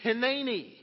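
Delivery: Hineni said as hih-NAY-nee, stress on NAY; Hineni, clear and falling at the end; Hebrew word Hineni. Shown as a demonstration. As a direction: model Hineni for the church to repeat. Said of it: "I want you to say it after me".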